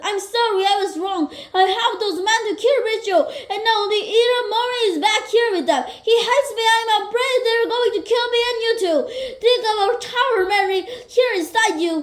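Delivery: high, fast, tearful pleading; scared husband begging his wife's forgiveness, crying; crying apology. A narrator's reading. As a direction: babble it out through sobs. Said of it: "why are you crying".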